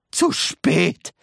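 high cry of alarm; shouting. A narrator's reading. Wonka shouting that the moment is missed. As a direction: cry out in dismay. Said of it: "schrie Mister Wonka".

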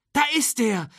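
high-pitched cry of discovery; excited call from the crowd. A narrator's reading. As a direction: call out as you spot him. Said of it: "rief jemand".

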